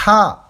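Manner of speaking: Ha pushed from the throat, loud and airy; breathy throat H. Shown as a demonstration. Teacher demonstrating Ha, air from the throat. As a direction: blow Ha out of the throat. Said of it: "vous sortez de l'air de votre gorge".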